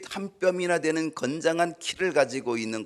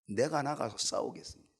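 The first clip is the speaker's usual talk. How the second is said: softer, calm and firm; quiet resolve; 8 dB quieter than usual. Acted. David quietly telling his brothers he will go fight. 다윗의 의지는 분명했습니다.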